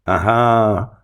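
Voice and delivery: gloating fiend's bellow. A narrator's reading. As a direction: bellow in triumph. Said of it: "bellowed the fiend".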